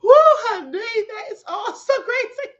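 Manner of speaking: high-pitched helpless laughter; cracking up, can't stop laughing; laughing hard. Real, not acted. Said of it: "Queen got me over here done and crying, laughing".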